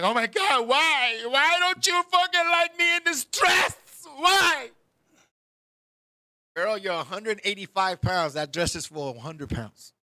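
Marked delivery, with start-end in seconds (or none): 0.0-4.7 shrill telenovela rant, louder and higher; mock telenovela fit. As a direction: throw a soap-opera tantrum at your man. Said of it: "Get mad at your man".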